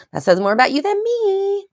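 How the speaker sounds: high, loud sing-song comeback; mock retort. Speaker teasing her online critics, in a high sing-song. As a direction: stretch the last word out on a high held note.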